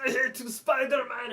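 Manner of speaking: high, loud mock Russian villain; yelled Russian accent. Gangster raging at Spider-Man, yelled Russian accent. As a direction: yell it like a crazed Russian gangster. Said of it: "he's got the Russian accent".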